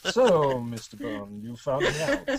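hammed-up evil mastermind unmasked; Bond villain. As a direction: savour each word like a movie supervillain. GM voicing villain caught out.

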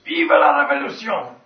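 loud, high-pitched French slogan; rallying cry. Acted. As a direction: shout it out for the revolution. GM repeats the banner slogan.

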